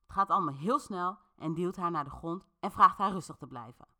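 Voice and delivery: plain reading voice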